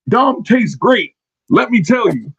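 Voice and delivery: slow, high mock announcer voice; mock quote. Host imitates Wade Barrett's line to his co-host. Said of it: "this is what Wade Barrett said, and I quote".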